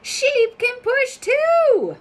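high, slow frog voice; croaking frog. Acted. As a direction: frog proudly sharing the idea, slowly. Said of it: "croaks Frog".

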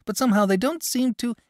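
the boy trails off, lightly voiced; plain young lad letting the sentence fade; boy's voice. Boy complains parents don't care, lightly voiced.

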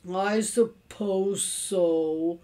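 very slow, drawn-out gloom; hammed-up glum resignation; doleful. A narrator's reading. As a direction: drag every word out dolefully. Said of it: "said Mister Carmody dolefully".

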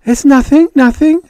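very high, loud denial; flustered babble. A narrator's reading. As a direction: blurt it out in a fluster. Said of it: "Judy babbled".

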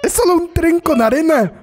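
high, amazed calling out; excited exclaim. Real, not acted. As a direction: exclaim at the screen in disbelief. Amazed at train video.

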